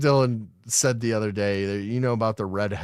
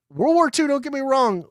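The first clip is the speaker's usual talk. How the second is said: excited high emphasis; 13 semitones higher than usual. Real, not acted.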